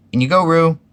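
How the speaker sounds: high, loud call. A narrator's reading. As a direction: call it out loudly, pitched well up. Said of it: "Rabbit said in loud voice".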